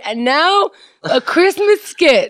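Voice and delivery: slow high showbiz announce; announcer. Pretend. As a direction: introduce the act like a host. Announcing a skit.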